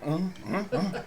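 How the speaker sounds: high little hums of approval, laughing; the little dwarf nods along with happy hums; high approving hums. Acted. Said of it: "little guy's like".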